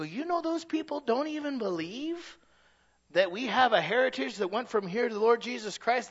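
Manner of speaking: rising challenge. Retold or imitated. Retold challenge to a friend.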